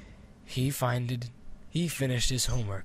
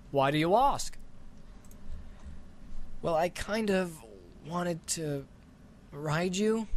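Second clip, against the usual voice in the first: put-on a touch higher, hesitant boy; shy boy asking the dragon, a bit higher; lightly voiced boy Scott; 5 semitones higher than usual.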